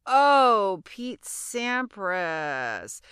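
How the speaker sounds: long loud oh, then very slow name; dawning realization. Retold or imitated. Finally understanding the French student, slow realization. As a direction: draw it out as it finally clicks.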